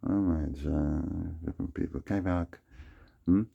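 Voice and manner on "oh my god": normal talking voice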